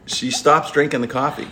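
ordinary delivery

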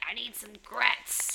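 lightly voiced raspy smoker; Greta the cigaretter voice. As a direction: croak it like a chain smoker. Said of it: "Greta the cigaretter voice".

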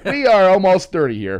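loud, high comic objection; mock protest. Answering her blame for the overdose, loud mock protest. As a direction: push back loudly, playing it up.

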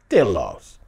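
low-pitched, matter-of-fact write-off; unbothered private verdict; cool dismissal. Acted. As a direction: drop it as the final word. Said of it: "That's what you thinking inside".

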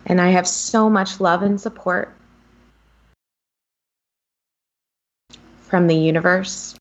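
calm tapping-script read; guided affirmation. Acted. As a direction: lead the listener gently through each line. Guided tapping affirmations, long pauses.

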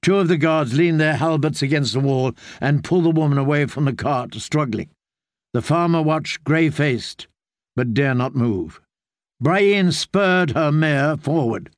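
usual speaking voice

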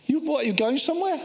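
high, halting question; incredulous Peter. Acted. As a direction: act a disciple who can't believe it. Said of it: "Read it through Peter's eyes".

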